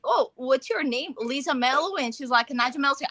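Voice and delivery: usual speaking voice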